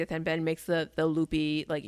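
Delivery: normal talking voice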